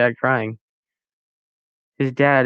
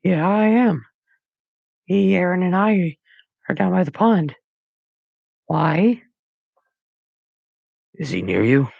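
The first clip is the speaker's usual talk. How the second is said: a scared son on the phone; worried teen voice; 8 semitones higher than usual. A narrator's reading. Max voiced by narrator.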